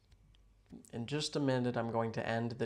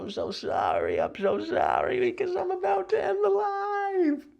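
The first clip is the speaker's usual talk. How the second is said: mock falsetto apology; 7 dB louder than usual.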